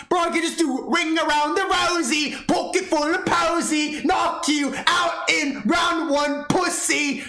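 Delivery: high, drawn-out nursery-rhyme taunt; taunting boast. Acted. Streamer boasting he would beat Daz in the ring, taunting.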